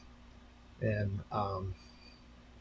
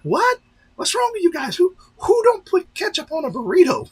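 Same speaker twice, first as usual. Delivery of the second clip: much higher and louder, incredulous squawk; mock outrage; 8 dB louder than usual. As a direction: play the baffled customer to the hilt. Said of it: "And he was like".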